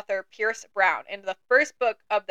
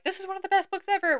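high, quick mock gushing; gushing readers imitated; 10 semitones higher, faster than usual. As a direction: imitate fans overpraising a book. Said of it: "they were like".

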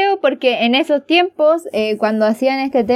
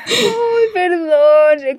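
embarrassed laugh; 5 semitones higher, much slower than usual. Real, not acted. Embarrassed after the slip.

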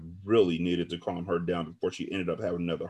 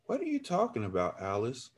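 cried back; 2 semitones higher, 8 dB quieter than usual. A narrator's reading. Narrator confused by friend's hint, crying softly back. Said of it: "I cried back to her".

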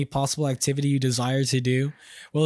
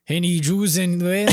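cliché German accent, hammed-up; bad movie German accent; 3 semitones higher than usual. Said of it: "In cliche German accent".

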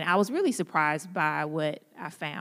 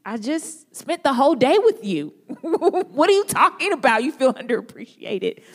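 higher, louder, laughing in disbelief; exasperated disbelief; 8 semitones higher, 6 dB louder than usual. Retold or imitated. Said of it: "And you're like".

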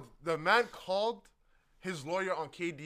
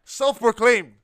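loud mock emphasis; 3 semitones higher, 14 dB louder, much slower than usual. Real, not acted. Mocking shout of the title.

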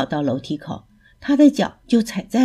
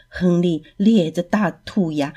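usual speaking voice

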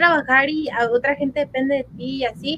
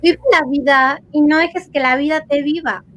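usual speaking voice

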